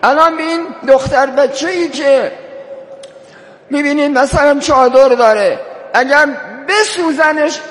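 high-pitched, insistent sermon delivery; impassioned preaching. Real, not acted. Preacher builds to impassioned exhortation. (موعظهٔ پرشور با صدای بالا)

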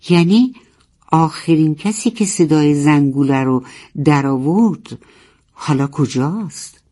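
ordinary delivery, nothing marked

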